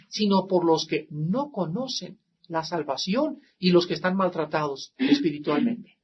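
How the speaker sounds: ordinary talk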